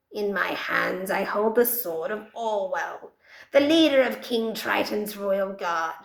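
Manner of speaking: a nervous underling addressing his queen; trembling wizard voice. Acted. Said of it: "his trembling voice responds".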